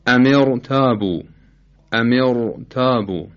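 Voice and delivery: امر تابو recited slowly with a thick ر; heavy r. Shown as a demonstration. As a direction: give امر تابو a full, velarized r. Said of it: "مثال دیگه".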